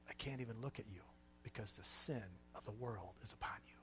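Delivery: whispered, barely audible; hushed whisper. Acted. Preacher voicing God to the dying Jesus, in a hushed whisper. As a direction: breathe it out as God turning away.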